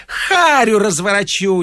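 a frenzied janitor threatening the locksmith; raging threat. Acted. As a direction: rage like he means to smash a face. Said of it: "неистовствовал дворник".

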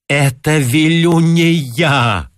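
loud, slow, high protest; shout. A narrator's reading. Pedant shouting at the director, loud and high. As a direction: shout it in outrage. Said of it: "крикнул Гарунский".

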